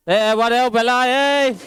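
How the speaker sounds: rising, drawn-out call for attention. Real, not acted. Teacher hailing noisy pupils in class, calling out. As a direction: call the rowdy pupils to order, pitch climbing.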